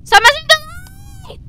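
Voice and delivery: high shrieked complaint; shrieking. Real, not acted. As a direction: shriek at them to quit. Yelling at a player.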